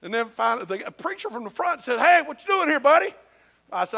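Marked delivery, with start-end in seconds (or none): 2.0-3.1 loud, higher friendly hail; calling out. Retold or imitated. Preacher hails a visitor from the front of church, calling out. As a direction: call across the room to someone you spot.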